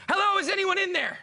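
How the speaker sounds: high, loud call into the van; urgent call out. Retold or imitated. Calling into the crashed van, high and loud. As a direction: call out like a hero cop, half laughing. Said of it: "and I go".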